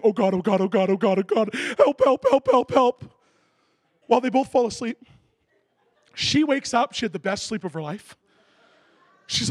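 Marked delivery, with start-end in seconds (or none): 0.0-3.0 mock panicked pleading. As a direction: imitate a frightened man praying for help. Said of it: "He's half off the other side of the bed saying".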